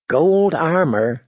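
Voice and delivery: as if heard through a haze; far off. A narrator's reading. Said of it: "Her voice sounded far off".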